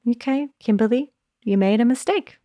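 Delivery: light, kind take on a mistake; gentle tone. Shown as a demonstration. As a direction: say it like a kind friend would. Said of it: "Same words, massive in different tone".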